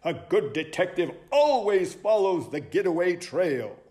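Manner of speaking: high, lively sleuth voice; excited high-pitched owl sleuth; eager owl detective. Acted. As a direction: announce it like a keen detective.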